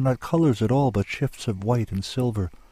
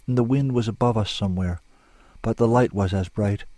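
ordinary delivery

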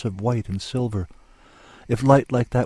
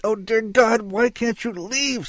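high, drawn-out desperate wail; anguished shriek; 12 semitones higher, slower than usual. A narrator's reading. Struggling woman begs her rescuer to let go, anguished shriek. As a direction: plead at a shriek. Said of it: "she shrieked".

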